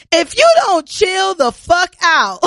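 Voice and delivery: drawn-out, high mock scolding; retold exasperated mother. Coworker tells crying daughter off over the phone, retold exasperated scolding.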